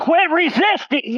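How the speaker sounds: high, slow forceful yell; shouting command. Real, not acted. Officer struggles to restrain man.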